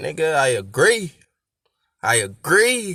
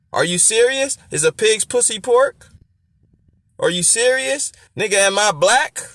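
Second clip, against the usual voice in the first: high, animated rhetorical questions; mock-incredulous questioning; incredulous back-and-forth; 6 semitones higher than usual. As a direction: ask it high, as if the answer's obvious. Acts out absurd rhetorical exchanges.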